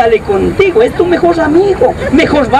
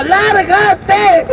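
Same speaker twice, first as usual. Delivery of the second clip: put-on angry girl; 6 semitones higher than usual. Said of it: "Y la chica brava".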